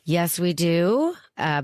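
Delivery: ordinary talk, nothing marked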